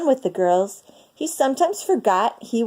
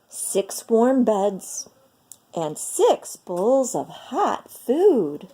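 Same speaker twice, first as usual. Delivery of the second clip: ordinary delivery, nothing marked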